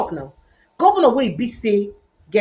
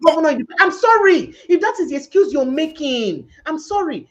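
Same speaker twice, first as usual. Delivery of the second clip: heated retort; 7 semitones higher, 5 dB louder than usual. Real, not acted. Host heatedly rebuts governor's excuse.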